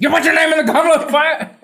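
high, abrupt accusation; hammed-up interrogation; frantic mock yell. Dumbledore turning to the next student, high mock yell.

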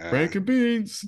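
slow high quote. Retold or imitated. Quoting Warren's catchphrase.

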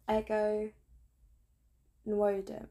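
"Ego Nwodim" in careful pieces, the O odd; slow, halting try at "Ego Nwodim"; weird O. Shown as a demonstration. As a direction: say "Ego", pause, then attempt "Nwodim" with a strange O. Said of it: "I say the O really weirdly".

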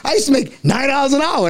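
high-pitched mom letting it slip; imitating his mom. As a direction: mom blurting out her old wage. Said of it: "she's like".

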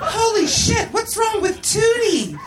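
high, slow exclamation; shocked alarm. A narrator's reading. Natalie walks in on tripping Tootie, shocked alarm.

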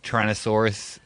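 ordinary talk, nothing marked

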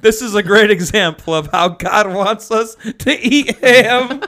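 high mock-solemn line cracking into laughter on "ham"; laughing read. Shown as a demonstration. Host reciting the doctored confirmation line, laughing. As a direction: read it like a pious sentence but lose it on "ham". Retells punchline while laughing.

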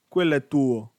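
loud on-field call. Retold or imitated. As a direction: shout it across the pitch. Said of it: "dice a Young".

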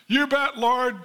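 hammed-up enthusiasm, words tumbling fast at the end; mock eager. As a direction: play an overeager volunteer, rushing the last line. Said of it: "Is that what he said?".

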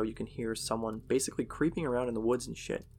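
ordinary delivery

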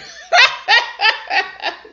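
big burst of high, loud laughter; real laugh. Real, not acted. Reader breaking into laughter during the story. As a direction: crack up at her own slip.

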